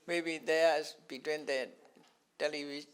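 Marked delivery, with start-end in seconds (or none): none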